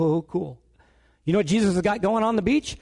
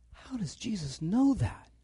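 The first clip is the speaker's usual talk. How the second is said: usual speaking voice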